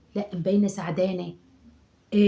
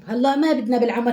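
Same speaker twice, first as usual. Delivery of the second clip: normal talking voice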